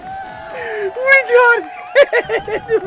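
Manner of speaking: high, loud victory yell; triumphant shouting. Real, not acted. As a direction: scream with joy at winning. Winning the level.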